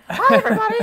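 loud, squeaky monster greeting; high-pitched monster. Acted. Playing against type, high-pitched monster. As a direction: shoot up into a loud falsetto monster hello. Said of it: "maybe he's a high-pitched monster".

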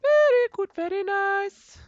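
very high falsetto, stretched out on nice; squeaky catchphrase voice. Pretend. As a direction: squeal it like a silly cartoon voice. Repeated catchphrase in put-on voice.

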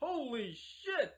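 shocked. Acted. Discovering the woman is a man.

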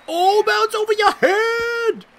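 high, slow excited cry. Real, not acted. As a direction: squeal as the ball flies over. Excited at big hit.

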